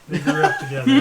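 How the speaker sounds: words breaking up with laughter, pitch jumping up; can't hold the laugh in while telling it. Real, not acted. Player cracking up while telling the druid story, laughing.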